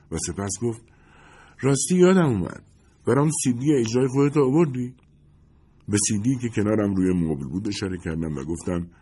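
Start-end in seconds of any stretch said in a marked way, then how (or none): 1.6-4.9 higher, lightly voiced glamorous woman; a hint of a starlet, pushing for gossip; Lindy's voice. Lindy pressing the narrator about Jake, lightly voiced higher woman.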